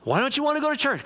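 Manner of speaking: high-pitched imitation of a wife; questioning wife. Wife questions husband staying in bed, insistent questioning. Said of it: "his wife came and said".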